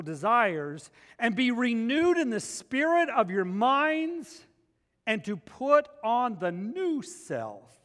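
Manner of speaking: preacher reading Ephesians aloud; scripture reading. Acted. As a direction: proclaim the verses slowly and with weight.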